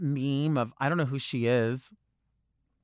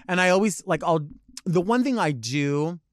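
ordinary delivery

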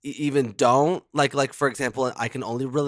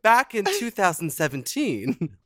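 ordinary talk

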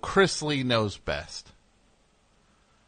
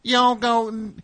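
yelling Southern dad; 10 semitones higher than usual. Retold or imitated. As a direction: imitate a Southern father telling off his daughter. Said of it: "he's yelling at his daughter".

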